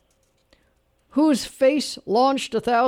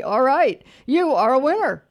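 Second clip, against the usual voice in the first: raised-pitch game-show winner call; triumphant announcement; 4 semitones higher, 5 dB louder than usual. Real, not acted. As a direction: proclaim the winner with gusto.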